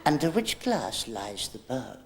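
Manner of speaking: slow, soft mystic question; mystic showman. Acted. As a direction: intone it like a fake psychic. Mystic magician act.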